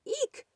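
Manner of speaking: very high startled eek; a mouse squeals in fright; frightened squeak. Acted. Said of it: "squeaked Mouse".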